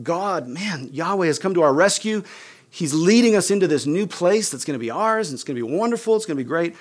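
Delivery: excited freed slaves. Retold or imitated. Freed slaves cheering God's rescue.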